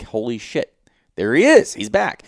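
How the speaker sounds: excited recognition. Retold or imitated. Spotting the big bull again.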